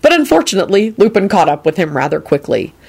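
ordinary delivery, nothing marked

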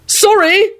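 very high, panicky apology; flustered high-pitched plea; desperate shout. A narrator's reading. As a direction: cry it out desperately. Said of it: "Neville shouted desperately".

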